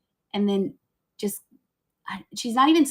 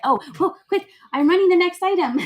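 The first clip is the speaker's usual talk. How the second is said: loud, high-pitched rush of alarm; flustered; 10 semitones higher, 8 dB louder than usual. Real, not acted. Seller to buyers after starting the next item by mistake, flustered. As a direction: blurt it out loudly, caught off guard.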